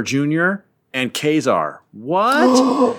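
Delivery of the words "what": high, loud "What?"; hammed-up gasp of surprise; mock shock. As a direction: sell the twist with exaggerated disbelief. Twist reveal: Kazar is his brother.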